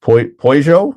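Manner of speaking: halting try at "Peugeot" with an oy vowel; German pronunciation. Shown as a demonstration. Streamer checks Dirk's spelling aloud, German pronunciation. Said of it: "That's a German pronunciation".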